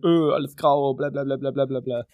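a grumbling whine, lightly put on; mock whining. Sleeping in the conservatory instead of moaning about Bremen rain, mock whine. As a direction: moan about the grey weather in a half-hearted mock whine. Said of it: "Ich hätte auch rumheulen können".

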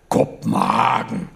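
brooding, deep, dragged out; gloomy. A narrator's reading. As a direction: drop the word into a gloomy pit. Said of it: "sagte Schröer düster".

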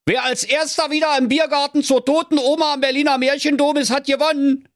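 high-pitched hare shouting back; calling out. Acted. Hare announcing the finish line, high calling voice. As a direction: cry it out high while running off. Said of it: "Der Hase rief noch".

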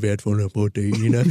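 a touch of a deep Ralf Möller; low brawny Ralf Möller impression. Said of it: "wertvolle Proteine als Ralf Möller".

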